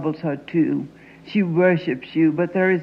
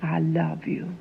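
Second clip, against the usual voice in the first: soft whispered confession; a tender whisper; 2 semitones lower, 7 dB quieter than usual. Acted. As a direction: let it slip out in a whisper. Tender confession after tirade.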